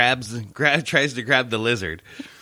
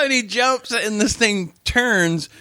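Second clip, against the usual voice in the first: usual speaking voice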